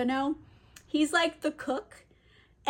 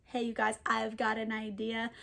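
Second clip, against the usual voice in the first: much softer, hushed aside; calmed down; 3 semitones lower, 9 dB quieter than usual. Retold or imitated. Caliban halts the slapstick to pitch his idea, calm and hushed. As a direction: draw them in quietly with a plan. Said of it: "calms down all the slapstick for a second".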